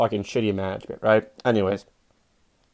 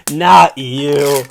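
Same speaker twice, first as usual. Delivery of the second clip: mocking repeat; 3 semitones higher, 9 dB louder than usual. Comics mocking him in car.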